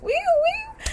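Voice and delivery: very high sustained siren wail; siren imitation. Police arriving in the story, high siren wail.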